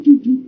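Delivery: high, loud sung doo doo; playful high loud sound effect; vocal sound effect. Shown as a demonstration. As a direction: mark the brow moves with a high doo doo. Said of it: "sort of goes doo".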